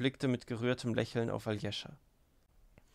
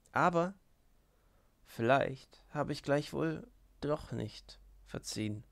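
half threatening; 2 semitones higher, slower than usual. A narrator's reading. Grushenka warns Aljescha she may not forgive, slowly and darkly. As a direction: let the threat creep in slowly. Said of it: "sprach sie wie drohend".

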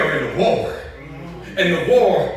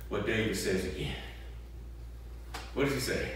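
ordinary talk, nothing marked

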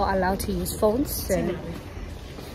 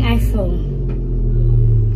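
deep drawn-out voice; 6 dB louder than usual. Pretend. Playful drawn-out punchline.

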